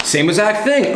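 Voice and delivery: ordinary talk, nothing marked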